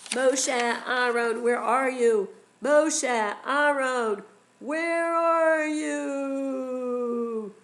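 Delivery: high, loud, long-drawn calls; calling out. Acted. Storyteller as Paro running through Mitzrayim, calling out. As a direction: holler slowly, stretching the last words out. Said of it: "running through Mitzrayim calling out".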